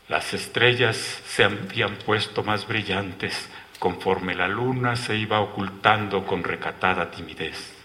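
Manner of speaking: plain reading voice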